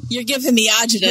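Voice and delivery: loud New York griping; put-upon New Yorker voice; New York accent. Acted. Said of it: "agita from time to time, which is New York".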